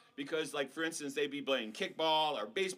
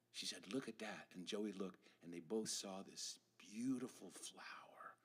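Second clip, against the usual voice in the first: hushed narration; 6 semitones lower, 10 dB quieter than usual. A narrator's reading.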